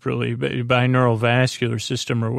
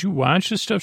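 mildly incredulous question, pitched up; higher-pitched, mock-surprised query; retold surprise; 7 semitones higher than usual. Doctor questioning Linda about the shows she watches, mildly incredulous.

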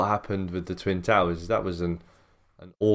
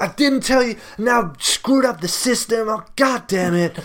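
mock angry officer; 6 dB louder than usual. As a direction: whine and rant like the fed-up officer. Retold officer ranting.

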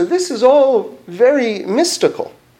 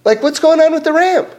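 incredulous question; 8 semitones higher than usual. Retold or imitated. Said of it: "They want to say".

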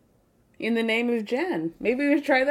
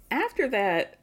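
ordinary talk

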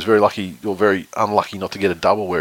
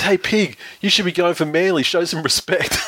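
imitating a yell, pitched high; 9 semitones higher than usual. Friend heckling the Hog's Breath mascot, high mock yell. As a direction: call out to the mascot in a raised, teasing voice. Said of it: "he yells out".

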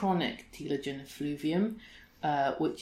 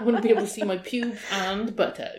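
laughing mock request; 5 semitones higher, 9 dB louder than usual. Mocks nineties jeans wish.

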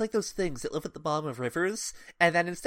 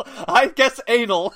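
high, slow, loud mock answer; loud contestant answer; 7 semitones higher, 10 dB louder, slower than usual. Contestant guessing on Family Feud, imitated loud and drawn out. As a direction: draw it out loudly for the whole studio.

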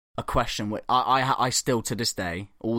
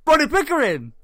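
high, loud repeat of the name; mock indignant shout; 7 dB louder than usual. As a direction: imitate an outraged man shouting his name. Second, louder repeat of the name.

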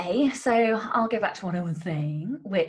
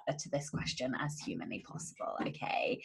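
hushed whisper; 8 semitones lower, 11 dB quieter than usual. Pretend. Dramatic setup of the big question.

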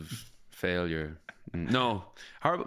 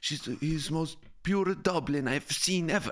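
high-pitched imitation of a foreign friend with Spanish-sounding accent; 8 semitones higher than usual. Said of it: "I don't know why she sounds Spanish".

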